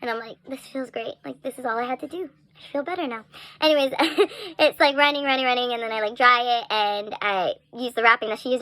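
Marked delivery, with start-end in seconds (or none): 0.5-3.2 much softer, sighing relief; quiet relief. Real, not acted. Toe under cold water in the bathroom, quiet relieved murmur.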